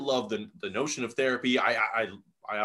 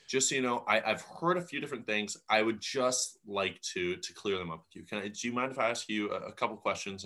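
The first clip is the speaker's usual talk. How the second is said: soft, low, measured approach; calm; 5 semitones lower, 6 dB quieter than usual. Retold or imitated. Imitating a man politely clearing things up, calm. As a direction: imitate someone gently asking, very softly. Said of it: "he walks over so calmly".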